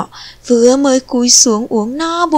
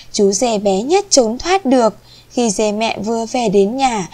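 ordinary delivery

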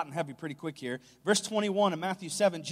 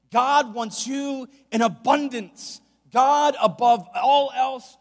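raised, higher preaching voice building in force; emphatic preaching; 5 semitones higher, 6 dB louder than usual. Acted. Preacher warning a congregation about a popular gospel, emphatic preaching. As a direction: preach it louder, stretching out the big phrases.

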